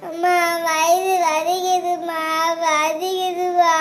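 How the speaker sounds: very slow, drawn-out moan; crying in pain. Acted. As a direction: moan to mother, stretching every word. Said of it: "சரி, அழுகாத". (வலியில் முனகி அழுதல்)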